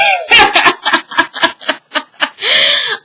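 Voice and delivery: long, high cackle; real laughter. Real, not acted. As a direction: burst out laughing at the reveal. Laughs at the reveal.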